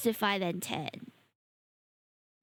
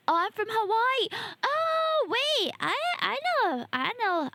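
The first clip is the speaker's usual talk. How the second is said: high excited squeal; light up with sudden recognition; 8 semitones higher than usual. Real, not acted. Excited realising friend is from Hawaii.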